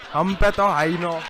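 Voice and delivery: put-on loud, slow laughing Nigerian accent. Comedian doing a bit, loud mock Nigerian accent. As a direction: belt it out in a hammed-up Nigerian accent. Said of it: "Nigerian accent.".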